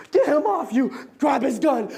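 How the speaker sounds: loud urgent shout; shouted orders. Acted. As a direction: yell it in panic. Violent scene, shouting orders.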